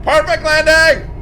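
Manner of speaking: loud, high celebratory yell; jubilant shout. Real, not acted. Streamer celebrating his landing to viewers, jubilant shout. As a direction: crow it triumphantly.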